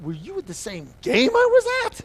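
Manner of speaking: high, loud outraged outburst; acted kid snapping back at dad; snapping in anger. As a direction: explode into an incredulous yell. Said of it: "I snapped. I just went".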